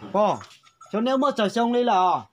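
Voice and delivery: loud, high and fast, calling out; to someone hard of hearing. Real, not acted. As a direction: raise your voice so grandma hears. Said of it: "phải nói to to bà cụ mới nghe được".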